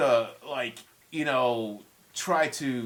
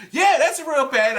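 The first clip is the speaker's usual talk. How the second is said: mock know-it-all; 11 semitones higher, 10 dB louder than usual. [name] insists band is real.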